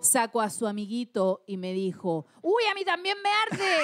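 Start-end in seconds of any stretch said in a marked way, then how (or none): 2.4-3.8 high-pitched yelp of a quote; acted pained alarm. Lover answers that it burns him too, mock alarm. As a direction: exclaim it in mock burning pain.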